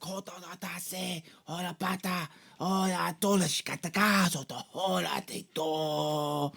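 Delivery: strained, drawn-out mock-Japanese last words; dying dad in mock Japanese. Dad gives last words to his son in slow mock Japanese. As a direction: put on a slow father's voice in fake Japanese. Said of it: "his dad says something along the lines".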